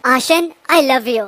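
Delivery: ordinary delivery, nothing marked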